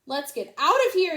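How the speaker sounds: loud urgent call; yelling. A narrator's reading. Duck yells to flee the chasing crowd. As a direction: get everyone moving. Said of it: "yelled Duck".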